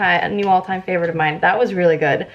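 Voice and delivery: ordinary delivery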